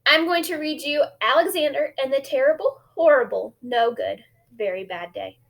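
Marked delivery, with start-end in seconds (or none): none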